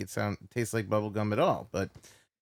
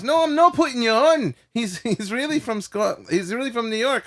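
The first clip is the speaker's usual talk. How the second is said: high-pitched, louder acted protest; insistent protest; 10 semitones higher, 5 dB louder than usual. Mark insisting to the driver it's no joke, insistent protest. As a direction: argue back in a raised, put-on voice.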